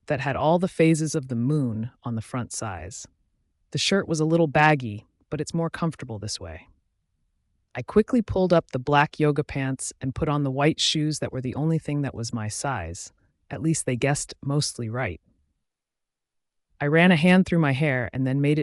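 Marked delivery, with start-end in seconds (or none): none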